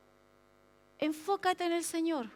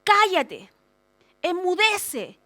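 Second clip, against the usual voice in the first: loud, higher commanding rebuke; orden con autoridad; 3 semitones higher, 7 dB louder, much slower than usual. Retold or imitated. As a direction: order silence with full authority. Jesús manda callar con autoridad. (orden tajante y enérgica)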